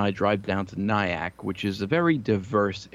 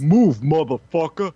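loud, slow, raised-pitch order; intimidating driver impression; 6 semitones higher, 8 dB louder than usual. Large driver ordering him aside from the window, loud menacing imitation. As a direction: imitate a loud, drawn-out threat. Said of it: "this big gorilla fucking face".

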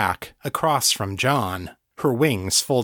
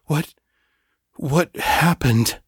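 groggy, half asleep; 5 semitones higher, 3 dB louder, slower than usual. Acted. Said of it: "in the tone of a man half asleep".

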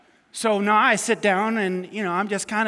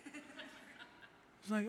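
usual speaking voice

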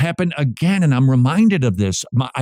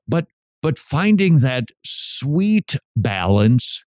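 ordinary talk

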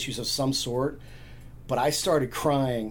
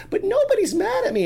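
put-on high, louder child impersonation; cute little girl; 5 dB louder than usual. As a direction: pipe up like a four-year-old. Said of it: "this cute little blonde girl".

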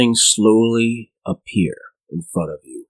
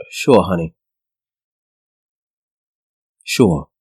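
lower, dry disbelief; sarcastic neighbor; 6 semitones lower, slower than usual. Acted. Old neighbor dismisses Trudy, low and dry. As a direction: humor her with a knowing sneer. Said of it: "It's like".

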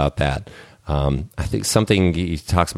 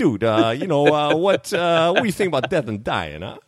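much higher, hammed-up New York accent; 10 semitones higher than usual. Stranger asking about death on the street, New York accent. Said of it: "I don't even know if I'm doing a good accent".

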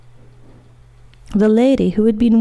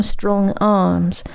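plain reading voice

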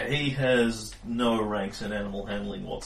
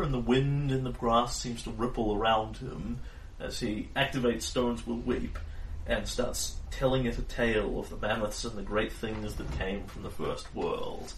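ordinary talk

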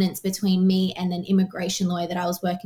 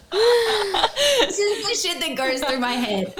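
much higher and slow, laughing through the words; laughing at herself; 12 semitones higher, 3 dB louder, slower than usual. Real, not acted. Admitting her true crime fears to friends, laughing, much higher. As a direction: giggle it out, self-mocking.

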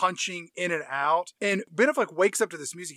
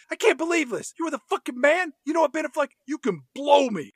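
mock livid; 8 semitones higher, 3 dB louder, faster than usual. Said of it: "She says".

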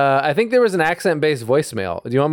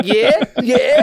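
higher-pitched imitation Cockney; mock Cockney; 7 semitones higher than usual. Friend teasing the comic about his bridge word, mock Cockney. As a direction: mimic a friend's Cockney slip. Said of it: "your bridge word is always like".

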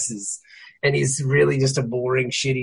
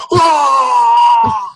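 long, loud high yell; child's sudden yell; 9 semitones higher, 9 dB louder than usual. Retold or imitated. Child yelling right in dad's face, sudden long yell. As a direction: yell out of nowhere, full force. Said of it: "literally just yells right in my face".

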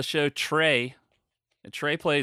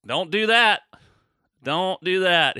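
higher, louder mock-panicked don't do that; mock plea; 5 semitones higher, 6 dB louder, slower than usual. Host begging a fan to skip old episodes, mock-panicked and louder. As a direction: plead with him, higher and louder, half joking.

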